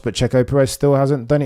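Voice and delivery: ordinary talk, nothing marked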